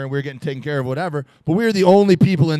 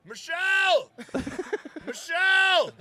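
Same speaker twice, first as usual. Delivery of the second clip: high yelling call across a crowded club; calling out a name; 13 semitones higher, 9 dB quieter, slower than usual. Retold or imitated. A worried dad calling for his daughter in a crowd, high yell. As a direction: cry out the name like a lost parent. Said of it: "So I just started going".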